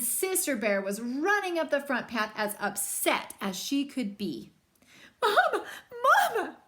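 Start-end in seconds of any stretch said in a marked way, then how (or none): 5.2-6.6 high, loud call for Mama; little cub shouting for her mother. Acted. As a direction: cry out for Mama, upset. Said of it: "she shouted".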